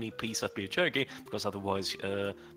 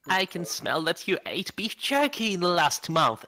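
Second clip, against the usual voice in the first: excited character voice; 8 semitones higher, 6 dB louder than usual. Acted. Character accuses friend of jerky.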